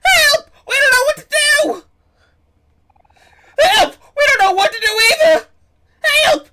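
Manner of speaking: shrill wailing screams of panic; panicked high cries for help. Acted. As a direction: cry for help, terrified and loud. Moles cry out in blackout.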